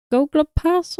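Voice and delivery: halting, high-pitched stab at "Golga Pass"; unsure butchered pronunciation. Real, not acted. Podcaster guessing at a film's foreign sweet name, unsure. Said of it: "I'm gonna butcher this so badly".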